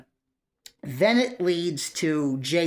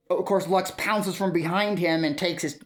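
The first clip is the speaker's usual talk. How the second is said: ordinary delivery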